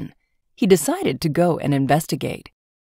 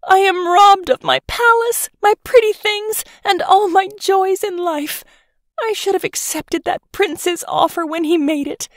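much higher, put-on whiny lament; keen over lost treasures; wailing grief; 12 semitones higher than usual. Banished princess to the swineherd, whiny wail. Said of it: "The princess wailed with grief".

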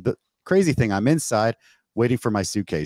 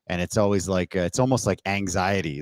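ordinary talk, nothing marked

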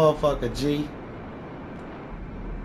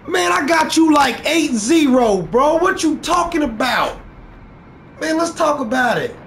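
heated shout; 7 semitones higher than usual. Real, not acted. Gamer shouting at rival about the score, heated. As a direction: yell him down.

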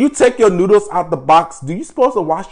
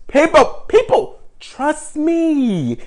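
high pleading; 9 semitones higher, much slower than usual. Real, not acted. Pleading with doubtful viewers.

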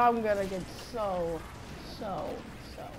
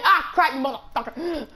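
high, sudden yelp into a curse; startled cursing; 5 semitones higher, 4 dB louder than usual. Real, not acted. Gamer caught out mid-fight, startled cursing. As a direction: blurt it out as it goes wrong.

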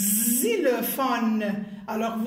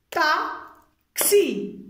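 TAXI as ta, pause, xi, pitched higher; syllable by syllable; 5 semitones higher, 4 dB louder than usual. Shown as a demonstration. Teacher showing pupils two syllables, said separately. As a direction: split taxi into two slow beats. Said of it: "Deux syllabes".

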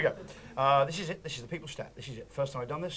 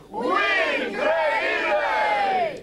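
high, loud cry with hugely stretched vowels; rallying cry; 14 semitones higher, 5 dB louder, much slower than usual. Pretend. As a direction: shout it out long and high like a crowd chant. Catchphrase shouted after "We cry.

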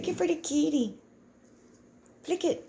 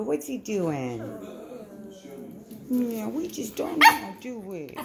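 normal talking voice

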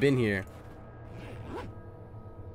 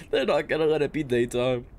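laughing. Real, not acted.

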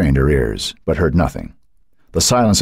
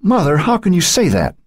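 protesting daughter; 14 semitones higher than usual. A narrator's reading. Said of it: "Joan protested".

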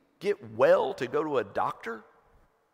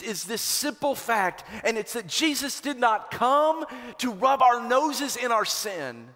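rising, louder preacher's build; fervent pulpit crescendo; preaching at full force; 7 semitones higher, 6 dB louder than usual. Acted. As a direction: drive it home like a revival preacher. Preaching climax on forgiveness.

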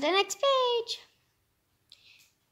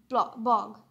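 plain reading voice

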